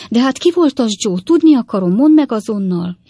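put-on loud, high, insistent old lady; agitated, high-pitched insistence; urgent demand. A narrator's reading. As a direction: demand an answer at once, raising the voice.

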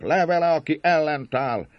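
high-pitched mob shouts; rallying cry from the crowd; crowd's roar. A narrator's reading. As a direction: urge everyone onward, calling out. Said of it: "zúgott a nép".

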